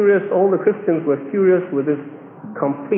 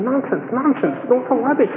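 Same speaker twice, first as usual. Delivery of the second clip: higher-pitched retold scoffing; raised-pitch, impatient disbelief; dismissive friend; 6 semitones higher than usual. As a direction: act out a friend brushing him off.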